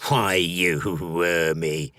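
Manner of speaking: slow, low forest-creature growl with a snort. A narrator's reading. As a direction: drag the words out slowly, like a grumpy creature. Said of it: "said the Gold Lock with a snort".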